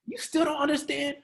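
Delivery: high, exasperated question; frustrated teacher. Shown as a demonstration. Frustrated Jesus asking Peter, exasperated. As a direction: sound fed up with the student. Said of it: "that's not how he said it".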